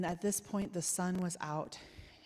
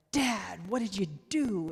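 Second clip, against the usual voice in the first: sharp, louder scolding call; annoyed grandmother; 6 dB louder than usual. Retold or imitated. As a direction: call out to him, clearly annoyed. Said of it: "So I knew she was annoyed".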